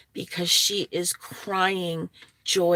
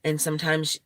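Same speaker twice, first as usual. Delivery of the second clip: usual speaking voice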